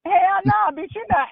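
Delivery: high-pitched indignant "Hell nah"; outraged refusal. Real, not acted. Telling Lance she won't eat the stinky food, high and indignant. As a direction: shoot the idea down, way up high.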